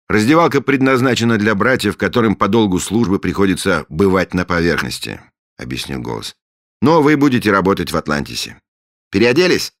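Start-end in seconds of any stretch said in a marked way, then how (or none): 0.1-5.2 commanding. Acted. Voice explains from another room. 6.8-9.7 commanding. Acted. Same commanding voice.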